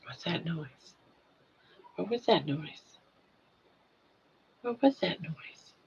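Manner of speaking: unvoiced hushed question; whisper. Real, not acted. Whispering to toddler.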